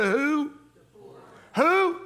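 calling out to crowd. Real, not acted. Pastor prompting church to shout poor, high call. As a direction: pull the answer out of them. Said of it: "prompting the congregation to answer".

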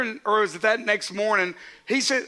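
normal talking voice

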